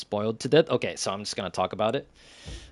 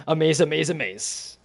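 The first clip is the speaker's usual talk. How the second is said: a lightly higher, put-on alien chirp; Rocky's alien voice; 7 semitones higher, 3 dB louder than usual. Fan quoting Rocky's catchphrase, light alien chirp. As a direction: repeat it like a delighted little alien. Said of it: "There's one".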